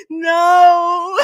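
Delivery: high, loud, slow laugh-crying disbelief; losing it, laughing so hard it cries; this can't be real, wheezing with laughter. Real, not acted.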